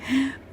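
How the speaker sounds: soft, high little giggle; small amused laugh; soft chuckle. Real, not acted. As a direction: giggle quietly after the question. Laughs after asking how it felt.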